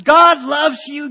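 put-on slow, loud declaration of love over a child; loud, slow father's blessing; fervent blessing. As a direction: proclaim it slowly and loudly, holding on. Blessing his kids at bedtime.

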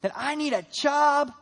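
loud, high, slow, pushy pleading; mock entitled prayer for a job; demanding prayer. Churchgoer demanding a job from God, loud mock pleading. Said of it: "that mindset of".

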